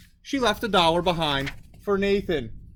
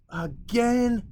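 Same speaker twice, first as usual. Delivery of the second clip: weary sigh; 6 semitones higher than usual. A narrator's reading. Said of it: "she sighed".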